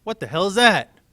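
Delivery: high, loud mocking outburst; bully sneering loudly; loud disgusted kid. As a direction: jeer at the lunch.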